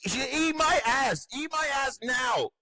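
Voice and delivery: very high, slow mock shriek; mock shrill yell. Lawyer screaming at the judge, very high slow mock screech. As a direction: stretch it into a hammed-up screech. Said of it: "He's like".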